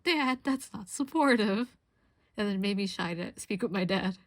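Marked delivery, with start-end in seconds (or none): 0.0-1.7 slow, louder, higher mock protest; mock complaint. To her laughing dad, a mock hurt protest, slow and raised. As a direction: complain to dad with playful indignation. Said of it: "I'm like".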